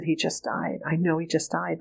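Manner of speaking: quiet, understated grief at three in the morning; retold grief. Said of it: "I was sobbing and crying".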